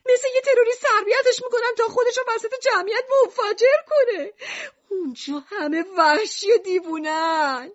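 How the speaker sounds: very high, fast, panicked wail; frantic shouted plea. A narrator's reading. Lucrece begs Mishima not to exile Alan, frantic. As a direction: plead hysterically, racing upward in pitch. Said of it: "رو به میشیما داد زد".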